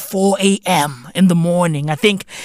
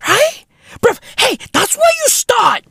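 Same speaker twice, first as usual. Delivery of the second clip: exasperated shout; 14 semitones higher than usual. Real, not acted. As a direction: yell it in disbelief at the game. Exasperated ranting about game restart.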